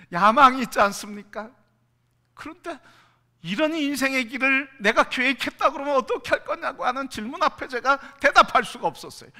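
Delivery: loud, high preaching; preaching at full force. Acted. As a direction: drive the question home to the hall.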